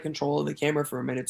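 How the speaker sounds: usual speaking voice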